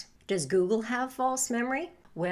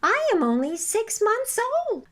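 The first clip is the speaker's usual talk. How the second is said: much higher little lamb; 12 semitones higher, 5 dB louder than usual. A narrator's reading. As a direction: plead innocence as the tiny lamb. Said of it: "said the lamb".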